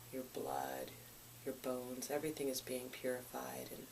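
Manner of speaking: calm, hushed meditation voice with long pauses; soothing, even-toned visualization, gradually softening; guided meditation. Real, not acted. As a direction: guide them gently through the body, phrase by phrase.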